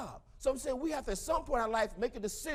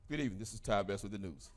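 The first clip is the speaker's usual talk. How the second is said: low, quiet mock anchor voice; mock newscaster intro; 13 semitones lower, 6 dB quieter than usual. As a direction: put on a deep news-anchor read.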